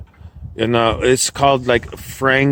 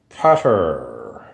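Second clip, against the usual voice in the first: ordinary talk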